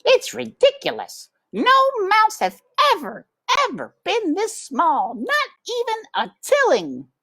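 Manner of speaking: put-on high, fussy old aunt voice; Aunt Florence. A narrator's reading. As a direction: squeak it out like a prim old aunt mouse.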